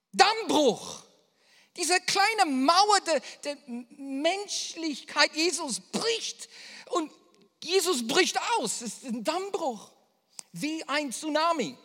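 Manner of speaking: loud, high, slow impassioned preaching; preaching at full force. Acted. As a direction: pour it out like a flood from the pulpit. (laute, leidenschaftliche Predigt)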